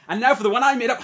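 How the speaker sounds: high, loud announcer mock; mock host voice. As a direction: play the smug reveal. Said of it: "you go".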